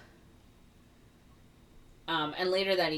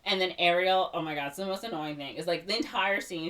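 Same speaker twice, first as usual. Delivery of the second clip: ordinary delivery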